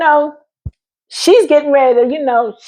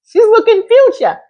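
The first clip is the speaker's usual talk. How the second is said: laughing through it, pitched high; giggly, high repeat of the line; 9 semitones higher than usual. Real, not acted. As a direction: crack up as you say it. Laughing at her own line.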